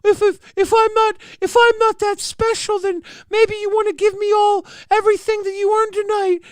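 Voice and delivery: very high-pitched mocking imitation; hammed-up falsetto take-off of someone pleading; Becky. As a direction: put on a high, squeaky voice for the quote. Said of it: "Becky even came in here and was like".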